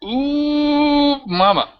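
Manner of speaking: gleeful GM squeal at the boss's turn; excited ooh. Real, not acted. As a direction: relish the villain's big moment.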